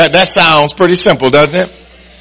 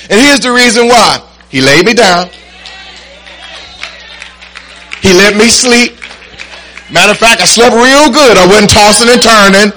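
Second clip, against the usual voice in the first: spaced phrases rising to a higher, emphatic peak; rousing preaching; 4 semitones higher than usual. Real, not acted. Preacher gives the reason for testimony, rousing and building.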